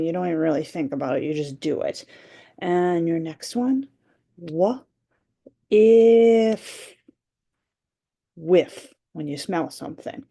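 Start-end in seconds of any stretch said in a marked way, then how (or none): 4.4-6.9 Wa- then a loud if; sounds pounded apart. Shown as a demonstration. Teacher pounding sounds for the kids, loud finish. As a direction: tap each sound out, the last one hard. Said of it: "I'm gonna pound the sounds".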